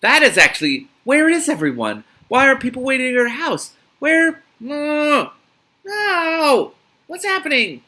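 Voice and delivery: high, loud, rising panic; alarmed outcry. Real, not acted. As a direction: cry out in alarm at the twist. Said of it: "reacting aloud to the story".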